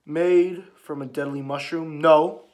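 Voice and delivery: slow, loud dramatic build to a shouted no; dramatic quote. Acted. Reading Hiluluk's speech aloud, slow and booming. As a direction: proclaim it, then hit the no hard.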